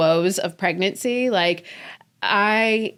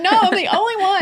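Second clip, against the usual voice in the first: much higher, louder laughing whine; mock despair; 12 semitones higher, 4 dB louder, faster than usual. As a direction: whine it like you're the only sufferer. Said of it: "And I'm like".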